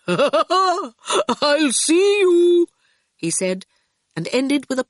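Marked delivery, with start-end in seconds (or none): 0.1-2.7 laugh, then a high, drawn-out goodbye; laughing farewell. A narrator's reading. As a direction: call it out slowly, high and loud after laughing. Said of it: "Hathaway's voice laughed".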